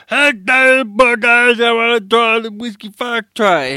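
high, slurred tipsy friend; beery, off-kilter mates voice; drunk voice. Retold or imitated. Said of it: "He sounded pretty off".

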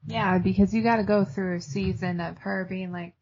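ordinary talk

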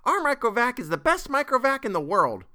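put-on high, loud child character voice; very high-pitched kid voice; little girl piping up. A narrator's reading. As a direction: pipe up proudly like a small child. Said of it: "Geraldette One said promptly".

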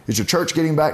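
mildly voiced neighbor asking eagerly; curious neighbor. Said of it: "she goes".

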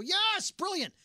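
high, bright-eyed mock praise; a fan gushing at a clever take, way up high; mock rave. Imagined podcast fans gushing over his point, high mock rave.

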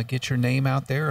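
ordinary delivery

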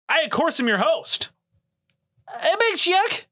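high, hammed-up host intro; showman host voice. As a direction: announce yourself grandly. Host's self-introduction showman voice.

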